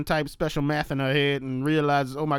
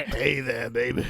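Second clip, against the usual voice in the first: gravelly biker drawl; 4 semitones lower, 3 dB quieter, slower than usual. Retold or imitated.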